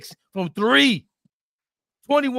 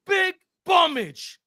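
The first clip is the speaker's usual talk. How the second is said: mocking; 8 semitones higher, slower than usual. Real, not acted. Roasting Celtics players.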